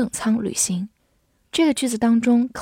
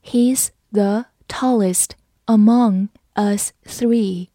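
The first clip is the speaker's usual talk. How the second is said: slow, careful reading for learners; slower than usual. Shown as a demonstration. Teacher reads slowly for the class. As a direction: read it slowly, word by word. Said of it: "好，慢读一遍。".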